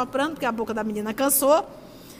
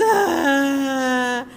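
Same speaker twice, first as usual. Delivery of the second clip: imitated crying wail; 6 dB louder than usual. Child crying on the plane.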